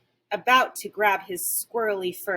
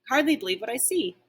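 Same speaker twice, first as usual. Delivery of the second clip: plain reading voice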